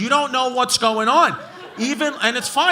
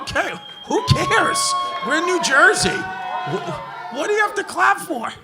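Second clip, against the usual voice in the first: exasperated rant; 8 semitones higher, slower than usual. Real, not acted. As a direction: shout them down in disbelief. Ranting at hometown clappers.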